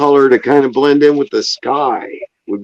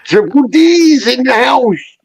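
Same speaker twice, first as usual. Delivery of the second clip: announcer shout; 10 semitones higher than usual. Pretend. Mock announcer hype call.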